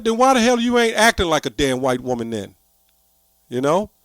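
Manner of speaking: ordinary delivery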